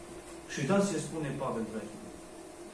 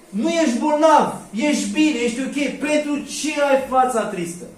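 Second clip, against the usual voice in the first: king's question; 7 semitones higher, 8 dB louder than usual. Acted. King questions sad servant.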